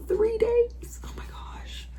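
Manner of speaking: high then whispered shock; stunned whisper. Real, not acted. Reader stunned by a chapter, whispered shock. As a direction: hush it in disbelief.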